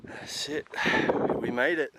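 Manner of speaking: panting between words at the summit; breathless, gasping after the climb; out of breath. Real, not acted. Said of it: "Just gotta catch my breath".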